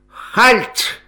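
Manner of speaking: high, loud shout of halt; shouted command. A narrator's reading. German soldier stops the family's boat, shouting an order. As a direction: call out a sharp military order.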